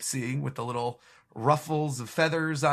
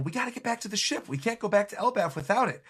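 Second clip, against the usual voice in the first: higher, fast anxious chatter; voiced Straw Hats; 7 semitones higher, faster than usual. Crew on the giant ship fret about the lost Sunny, fast and worried. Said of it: "still going".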